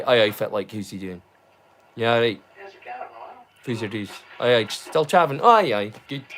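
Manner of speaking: low broad Doric "Aye, aye, fit like". Shown as a demonstration. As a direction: greet a friend in Doric. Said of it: "This is how we say".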